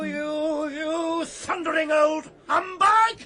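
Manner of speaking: loud, high shouting insult; furious insult. Acted. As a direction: roar it at the old boss. Shop quarrel, insult.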